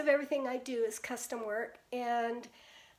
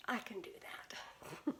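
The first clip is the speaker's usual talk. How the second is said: softer, slow whispered aside; whispered thought; 4 semitones lower, 12 dB quieter, slower than usual. Retold or imitated. Grandma recalls her private answer to granddaughter, whispering. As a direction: whisper it like a secret promise.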